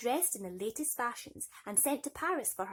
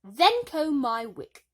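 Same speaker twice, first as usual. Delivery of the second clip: high, loud, slow command; haughty stepmother; 6 semitones higher, 13 dB louder, slower than usual. A narrator's reading. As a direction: order her like a servant. Stepmother ordering.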